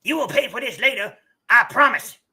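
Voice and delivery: high, fast weaselly threat. Acted. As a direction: hiss a petty threat like a weasel. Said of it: "he's getting weasel voice".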